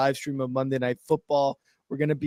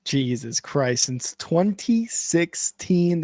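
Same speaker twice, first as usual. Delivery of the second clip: normal talking voice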